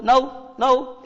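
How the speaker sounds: नाउ, नाउ said slowly with no k sound; silent k. Shown as a demonstration. Teacher modelling know with silent k for children. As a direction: say नाउ twice, slow, no k. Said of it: "अब बोलो".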